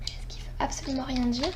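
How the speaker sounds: a soft whispered aside; whispered as if in confidence. Real, not acted. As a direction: drop to a whisper for a quick aside.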